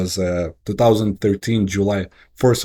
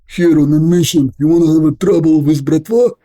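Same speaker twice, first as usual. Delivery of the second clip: put-on higher, louder tough-guy Russian accent, menacing; fake Russian accent; 8 semitones higher, 6 dB louder than usual. Faking a Russian accent to scare off armed gang, aggressive. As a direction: bluff a hard Russian mobster accent to scare them off. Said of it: "And I start faking it".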